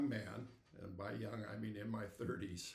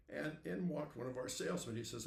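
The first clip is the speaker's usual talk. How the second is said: ordinary delivery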